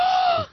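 sharp, high gasp; shocked gasp. Real, not acted. Host reacting to old minimum wage, shocked gasp. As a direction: gasp in surprise.

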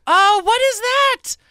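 high, slow surprised "oh, what is that"; slow it down in shock. Real, not acted. Surprise at cheesecake.

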